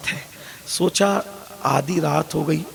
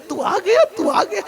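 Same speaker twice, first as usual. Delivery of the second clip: crying for joy. Acted. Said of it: "روتے کہ".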